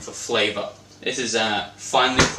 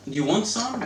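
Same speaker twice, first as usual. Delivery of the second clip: coaxing offer; 10 semitones higher than usual. Acted. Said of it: "You ask politely".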